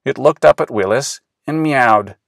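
ordinary delivery, nothing marked

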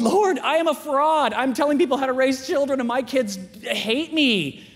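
high, loud pleading confession; retold anguished plea; desperate prayer. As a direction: cry out to God, drawing the words out. Acts out desperate late-night prayer.

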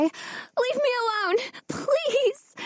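high, desperate begging; terrified pleading. A narrator's reading. Terrified girl pleads with shadow.

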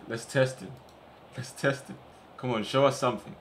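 normal talking voice

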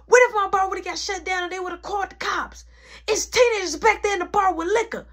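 loud, high-pitched scolding tirade; mama snapping. Retold or imitated. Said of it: "My mama snapping".

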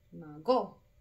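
"mago" as ma-GÓ, strong ending; stress on GO. Shown as a demonstration. Teacher repeating wrong option for pupils, "mago" stressed on GO. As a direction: punch GO at the end of "mago". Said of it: "la sílaba tónica".

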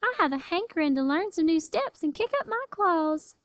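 high, lilting little armadillo sister; eager suggestion. A narrator's reading. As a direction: pitch it up, keen to go dancing. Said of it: "suggested Lily".

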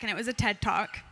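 usual speaking voice

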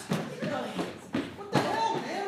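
high, slow indignant cry; exasperated. Acted. Jake confronting his late partner, high and exasperated. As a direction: demand where he was.

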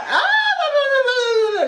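long, high held wail; high drawn-out "ah" of exasperation; exasperated wail. Real, not acted. Viewer fed up with beach decision, high wail.

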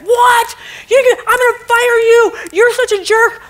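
high, loud furious boss; angry boss. Acted. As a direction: yell at the worker, then insult him. Boss yelling at employee.

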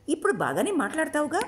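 ఇప్పుడు lifted high, teasing rising question; Shabari cutting in. A narrator's reading. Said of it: "మధ్యలో అందుకొని అడిగింది శబరి". (మధ్యలో అందుకొని ఎత్తైన స్వరంతో ప్రశ్న)

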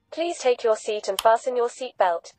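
ordinary talk, nothing marked

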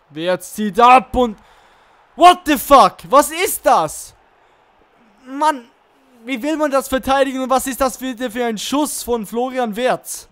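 loud, high furious yelling; berserk rage. Real, not acted. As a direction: explode at the screen. Conceding another goal, raging.

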